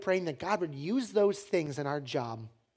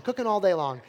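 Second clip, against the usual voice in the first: ordinary delivery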